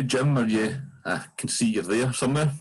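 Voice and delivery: ordinary talk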